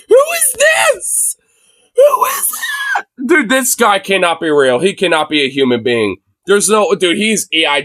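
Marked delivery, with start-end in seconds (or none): 0.1-3.1 very high, drawn-out squealed question; incredulous squeal. Real, not acted. Streamer baffled by man in clip, incredulous squeal. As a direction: squeal it slowly in total disbelief.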